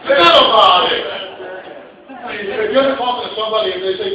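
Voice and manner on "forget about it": loud, punchy New York "Forget about it"; New York expression. Shown as a demonstration. Comic shows the crowd how New Yorkers talk, loud New York accent. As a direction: throw it out like a brash New Yorker. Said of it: "then you know they're from New York".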